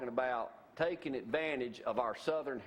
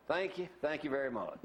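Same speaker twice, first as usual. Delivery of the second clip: normal talking voice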